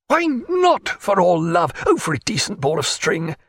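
a startled why not; incredulous naturalist. A narrator's reading. Naturalist protesting the captain's rule, high and incredulous.

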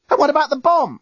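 very high, urgent cry; alarmed scream. A narrator's reading. Lucy remembering the bomb, screaming in alarm. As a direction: shriek the question in alarm. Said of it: "Lucy suddenly screamed".

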